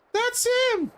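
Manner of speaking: very high falsetto; put-on high girly voice; squealing girl character.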